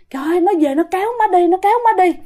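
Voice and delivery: high, hurried retelling of distress; mild alarm. Retold or imitated. Mother tells daughter about the ghost dragging her, mildly alarmed. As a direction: blurt it out, mildly panicked. Shaken mother reports nightmare.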